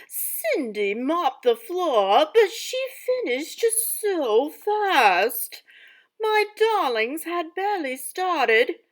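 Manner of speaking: loud, lively storybook narration; dramatic story reading. Acted. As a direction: read it aloud like a theatrical stepmother. Teacher reads stepmother's story aloud.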